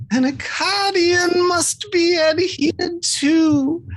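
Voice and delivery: high, solemn creature voice. Acted. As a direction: recite the law as an otherworldly being. GM holds creature voice.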